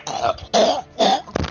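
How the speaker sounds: exaggerated choking noises; mock gagging. Gamer mocking the developer's fans, pretend gagging. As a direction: fake a crude, throaty gag. Mimes gagging between insults.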